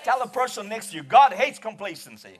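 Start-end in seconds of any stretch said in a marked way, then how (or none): 1.0-2.4 loud, forceful shout leaning on "hates"; emphatic HATES. Shown as a demonstration. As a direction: belt it out, punching "hates". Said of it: "little more emphasis on the word hate".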